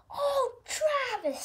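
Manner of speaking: high, loud exasperated groan. Acted. Spud groans at slow Travis, exasperated. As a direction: let it out like a weary groan. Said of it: "with a groan".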